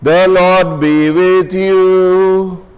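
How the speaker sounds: ordinary talk, nothing marked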